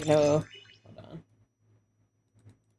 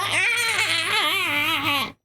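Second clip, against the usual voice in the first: startled shriek; 4 semitones higher than usual. Real, not acted. Water effect hits her.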